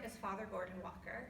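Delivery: ordinary talk, nothing marked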